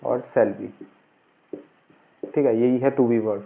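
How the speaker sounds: ordinary delivery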